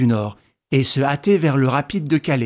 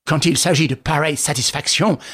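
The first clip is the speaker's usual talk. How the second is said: ordinary delivery, nothing marked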